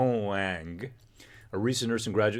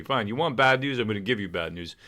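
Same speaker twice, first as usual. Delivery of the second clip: usual speaking voice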